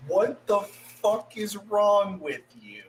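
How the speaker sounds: loud, high, drawn-out mock outburst; acted outraged disbelief. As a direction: stretch each word in exasperated disbelief. Acted outraged reaction to marriage plan.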